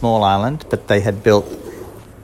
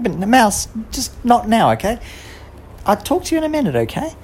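higher, brisk put-off; impatient girl; 14 semitones higher than usual. Acted. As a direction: wave the mouse away. Girl putting mouse off.

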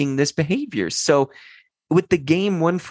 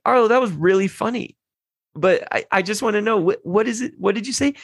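mock gentle coaxing; 7 semitones higher than usual. Dad retells coaxing son.

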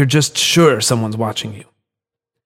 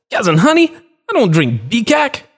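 angry yelling; 12 semitones higher than usual. Acted. Withdrawn stranger yelling at a food merchant, high and angry. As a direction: snap at the merchant in a twitchy rage.

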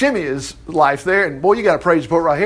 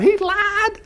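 high-pitched mock outrage; hammed-up high protest; protesting accusation; 13 semitones higher than usual. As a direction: squeal the accusation, voice jumping up. Mephibosheth's protest, acted and repeated.

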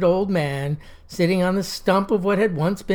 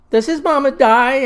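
put-on higher, louder worried child's question; small girl asking; 7 semitones higher, 5 dB louder than usual. Little girl asks the storyteller, higher and worried. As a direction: ask it like an anxious little girl. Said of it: "asked little Pepper Johnson".

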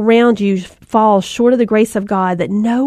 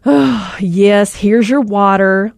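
put-upon parent answering with a heavy sigh; weary, grudging exasperation; exasperated; 2 semitones lower, slower than usual. Shown as a demonstration. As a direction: huff it out, fed up with being asked. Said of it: "you give this tone of exasperation".

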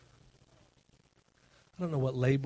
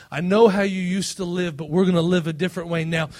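raised, louder sermon voice driving each line home; preaching at full force; 8 semitones higher, 5 dB louder than usual. Acted. Pastor urging the congregation toward the Father, preaching loud. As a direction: build to a stirring climax.

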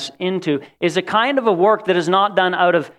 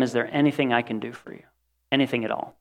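gentle bedside offer; 5 semitones lower, 7 dB quieter, faster than usual. Retold or imitated. At dying friend's bedside.

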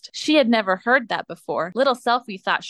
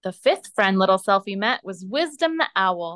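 plain reading voice

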